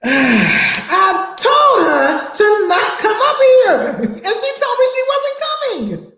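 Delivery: high, laughing disbelief; exasperated laughing. Retold or imitated. Teacher tells the AP the mom came anyway, laughing in disbelief.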